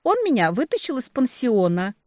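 high, loud, cutesy gushing; gushing baby talk. A narrator's reading. Little sister praising her boyfriend, gushing baby talk. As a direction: gush like a smitten little sister. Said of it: "засюсюкала сестрёнка".

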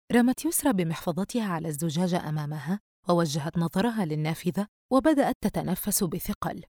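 ordinary delivery, nothing marked

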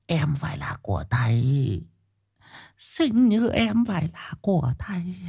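high, slow, leering villain; creepy shaman voice. Shown as a demonstration. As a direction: leer like a sleazy sorcerer. Lecherous shaman villain demo.

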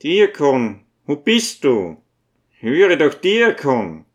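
put-on old man calling for the deacon. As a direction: call out for someone in another room. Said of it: "und rief".

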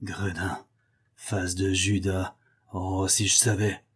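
Joey threatening the host under his breath; murmured through gritted teeth. A narrator's reading. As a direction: spit it low and menacing through clenched teeth. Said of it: "murmure, les dents serrées".

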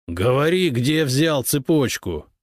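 медленно и громко, с нажимом; рявкни на задержанного; грозный рык. A narrator's reading. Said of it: "прорычал он". (следователь рычит на пьяницу, требуя ответа, громко и медленно)

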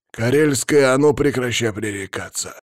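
loud scolding exclamation; exclaiming. A narrator's reading. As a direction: call her out sharply and loud. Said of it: "воскликнул Палыч".

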